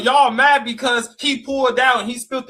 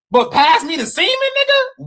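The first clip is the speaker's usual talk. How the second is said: incredulous punchline; 9 semitones higher, 5 dB louder than usual. Acted. Mocking the cooks in disbelief, voice shooting up. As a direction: pitch jumps high and loud on the last word.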